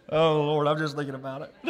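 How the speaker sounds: real laughter breaking into the telling; laughing at himself. Real, not acted. Preacher tickled by his own shawl story, laughing as he talks. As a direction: try to keep going while cracking up at your own story. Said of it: "I don't mean to laugh at my own self".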